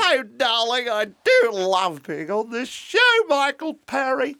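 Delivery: very high, drawn-out Australian diva; shrieking falsetto Aussie hostess; Dame Edna Everage. Acted. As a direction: gush like a grande dame on a chat show. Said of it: "That's Dame Edna Everage".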